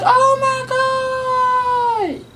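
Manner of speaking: long high stretched oh my god; drawn-out shock. Retold or imitated. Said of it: "we was like".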